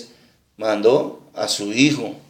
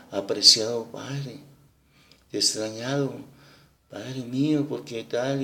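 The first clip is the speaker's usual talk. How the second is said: soft tender reading; 8 dB quieter than usual. Retold or imitated. Young woman reads the son's letter to the old father, gently and softly. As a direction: read the letter lovingly and softly. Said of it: "a leérsela con una suavidad y con un cariño y un amor".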